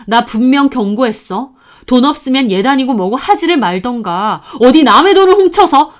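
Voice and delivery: loud, furious warning to the sister-in-law; wife scolding the sister-in-law on the phone. Retold or imitated. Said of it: "저도 너무 열받더라고요".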